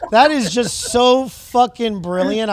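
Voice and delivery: loud, drawn-out amazement; amazed delight. Real, not acted. Host reacting to the viral rant, amazed delight. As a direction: stretch it out, loudly bowled over.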